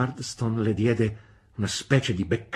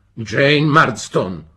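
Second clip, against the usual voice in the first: slow, loud, higher roar of her name; thundering; 6 semitones higher, 12 dB louder, much slower than usual. A narrator's reading. Brother silencing his sister, thundering and slow. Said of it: "tuonò mister Marston".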